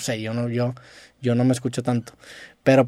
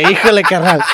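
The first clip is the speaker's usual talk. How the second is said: mock fresa voice with a laugh; 4 semitones higher, 5 dB louder than usual. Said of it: "eso es muy".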